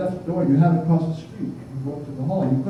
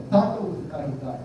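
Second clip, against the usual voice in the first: ordinary delivery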